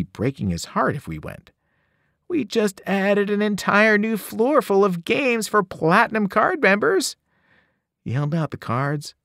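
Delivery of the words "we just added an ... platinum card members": tempting salesman. Acted. Bellhop tempting them.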